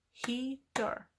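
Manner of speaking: "Heater" split into two beats, hea, ter; syllable by syllable. Shown as a demonstration. Teacher counting two syllables for learners, syllable by syllable. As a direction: say "Heater" with a clear gap between its syllables. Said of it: "Two syllables".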